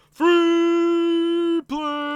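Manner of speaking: very slow, loud, high; excited announcement. Real, not acted. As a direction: stretch it out like an announcer. Announcing free play.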